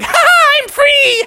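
very high, laughing squeal; high-pitched escape cheer; exultant baby frog. Acted. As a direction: crow in triumph, squeaky and loud. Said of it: "Baby was like".

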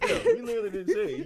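high, loud, fast through laughter; laughing too hard to stay calm; laughing protest. Real, not acted. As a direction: protest while cracking up. Laughing at co-host's denial.

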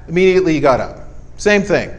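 normal talking voice

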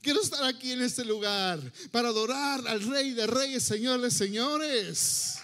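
loud, exultant proclamation; joyful attitude. Acted. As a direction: declare it like a fired-up worshipper. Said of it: "una actitud alegre".

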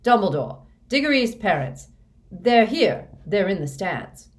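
high, loud, agitated bursts; saying loudly. A narrator's reading. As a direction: fret out loud in urgent short bursts. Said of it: "Fudge was saying loudly".

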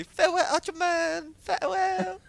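very high, wailing falsetto farewell; high falsetto imitation of fans bidding farewell; mimicking farewell cry. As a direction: call it out like a tearful crowd seeing the hero off. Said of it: "a mimic of what they were saying and how they said it".

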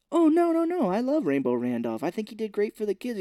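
high opening, sweetly insistent; lightly put-on wholesome voice; mock earnest denial. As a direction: play the nice guy protesting. Acting out earnest kids' host.

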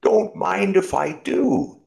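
slow, high, loud acceptance; old road sweeper accepting coffee; grateful road sweeper. Acted. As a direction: accept gladly, drawing it out. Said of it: "he said".

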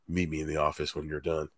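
low, hushed imitation of a boss; retold whisper. Boss discreetly summoning a worker on the floor, retold whisper. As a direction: drop low and soft, like a private word in the ear. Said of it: "whispers in my ear".

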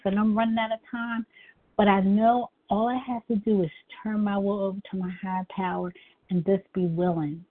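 ordinary delivery

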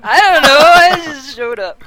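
laughing. Real, not acted. Laughing while answering.

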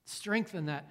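slow, measured prayer with long pauses; spoken prayer. Real, not acted. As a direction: pray slowly, letting each phrase settle. Leads the congregation in prayer.

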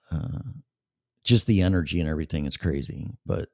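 normal talking voice

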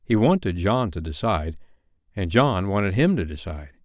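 ordinary delivery, nothing marked

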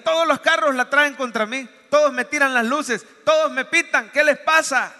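a mock rant at the honking cars; indignant complaint. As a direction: play the outraged wrong-way driver. Driver complaining on the road.

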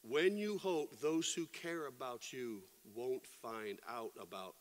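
usual speaking voice

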